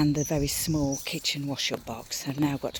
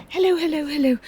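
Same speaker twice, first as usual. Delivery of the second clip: pet talk; 11 semitones higher, 8 dB louder than usual. Real, not acted. Greeting the dog.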